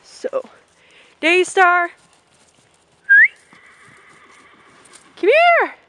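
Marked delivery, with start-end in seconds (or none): 1.2-5.8 very loud, high shout across the field. Real, not acted. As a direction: call her from far away. Calling a far-off pony.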